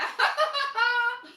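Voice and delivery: a burst of high, loud laughter; real laughter. Real, not acted. Laughs at viewer's comment.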